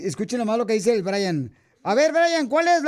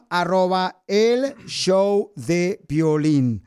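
drawn-out show plug; 5 semitones lower, much slower than usual. Acted. Radio host plugging the show.

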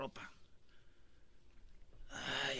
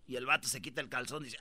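ordinary talk, nothing marked

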